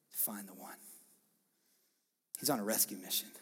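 normal talking voice

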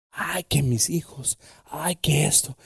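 put-on whispered, worried sigh over the kids; whispered fretting parent. Preacher voicing a fretting parent, whispered. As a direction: fret in a whisper about your children.

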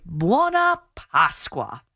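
"Buona Pasqua" in Italian, drawn out slowly; Italian pronunciation. Shown as a demonstration. Italian teacher giving listeners an Easter greeting, slow Italian pronunciation. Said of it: "And in Italian, we say".

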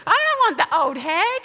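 ordinary talk

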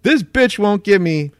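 loud furious snap at bystanders; a stranger at checkout shouting furiously at the table; angry yelling. Retold or imitated. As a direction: yell it hard at the nosy onlookers. Stranger yells at narrator's table.

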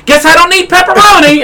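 loud, high mock-indignant announcement; re-enacted tantrum, pitch way up; angry outburst. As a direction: announce it huffily to the whole counter. Acts out angry outburst at counter.

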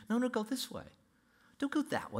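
guiding inner voice. Acted. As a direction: steer them like a voice over the shoulder. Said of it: "there's gonna be a voice behind you".

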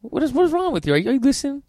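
high exasperated disbelief. Real, not acted. Cohost exasperated by odd repeats.

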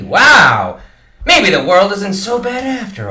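host thrilled to hear about the movie; delighted wow. Acted. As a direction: burst out in amazement. Hears Tom Hanks plays him.

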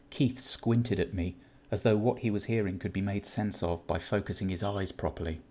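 ordinary delivery, nothing marked

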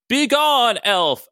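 loud, high, grand command from above; commanding goddess voice. Acted. Storm landing next to Ryan, grand commanding loud voice. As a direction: banish him like a storm goddess.

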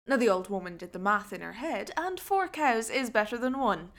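plain reading voice

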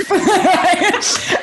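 loud high laugh breaking out; real burst of laughter. Real, not acted. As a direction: laugh out hard at the memory. Laughs at own story.

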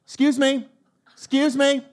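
lightly voiced insistent woman calling through glass; a hint of a stranger tapping at the window; impersonating a woman. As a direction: mimic her pushy "Excuse me". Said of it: "there's a woman who's now about".

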